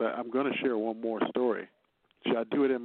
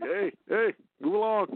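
shooing someone along; 10 semitones higher, 5 dB louder, slower than usual. Retold or imitated. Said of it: "that little poke that people do".

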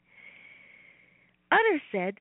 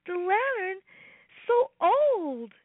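put-on child voice; 7 semitones higher, slower than usual. Robbie voiced in story.

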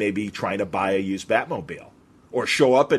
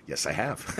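low understated reply; 5 semitones lower, 8 dB quieter than usual. Retold or imitated. Deadpan answer in retold exchange.

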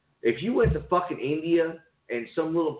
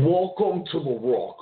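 Sean Connery impression; 3 semitones higher, slower than usual. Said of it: "the Sean Connery portion of the program".

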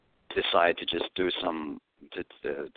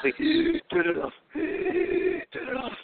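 mock-helpless, very high wheezy plea; dying of laughter; much slower than usual. Begging friends to stop the comedy video, squealing with acted laughter. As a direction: beg in a high squeal, as if laughing too hard. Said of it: "like that".

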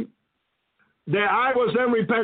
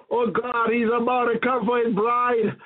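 ordinary talk

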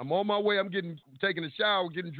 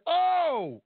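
high, loud little oh of mock surprise; mock surprised oh; 11 semitones higher, 4 dB louder than usual. Telling the detective in mock surprise.

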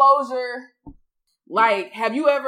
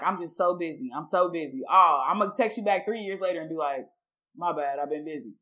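lower, softer imitation of brushing someone off; offhand excuse; 7 semitones lower, 4 dB quieter than usual. Ghoster texting someone back with an excuse, offhand. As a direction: toss off the mock excuses low and soft.

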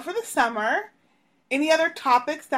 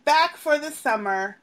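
ordinary delivery

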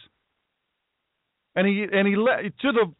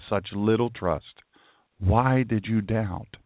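gentle rebuke; 10 semitones lower, slower than usual. Acted. Jesus gently rebuking Peter.

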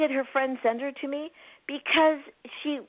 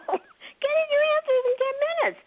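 much higher, laughing title; gleeful title; 3 dB louder than usual. Real, not acted. Plugging her ebook to the host, high and giggly. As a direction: sing out the book title with glee. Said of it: "Is that cool?".

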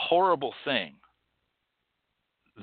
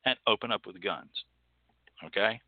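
ordinary talk

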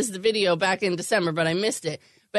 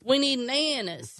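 a hint of the little boy's Kentucky twang; mock Kentucky toddler; 4 semitones higher, slower than usual. Host imitates the boy's Kentucky accent, lightly. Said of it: "It's the accent".

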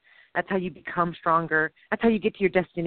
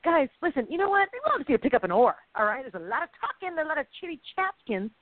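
mock scolding; 6 semitones higher than usual. Voices a boss scolding idle rowers.

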